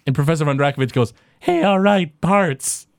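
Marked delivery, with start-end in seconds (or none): 1.4-2.9 professor's voice. Acted.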